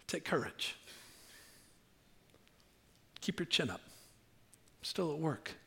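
very soft, slowing, tender voice; acted quiet, soothing words; gentle reassurance. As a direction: hush down to comfort someone frightened. Jesus reassures the frightened woman.

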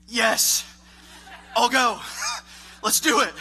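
loud, laughing eager yes. Retold or imitated. Coworkers ask him to lunch, loud laughing eager yes. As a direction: jump at the chance to go eat, laughing.

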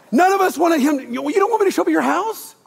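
high, loud incredulous cry; mock disbelief. Pastor acting a host aghast at Jesus visiting, high incredulous. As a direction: shout it like you can't believe it.

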